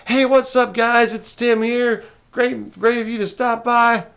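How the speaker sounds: high, loud hype YouTube intro voice; big, loud, high-pitched channel greeting; peppy YouTuber. Acted. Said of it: "be more peppy. I need to be more of a YouTuber".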